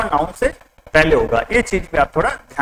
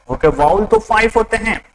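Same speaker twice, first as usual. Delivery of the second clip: ordinary talk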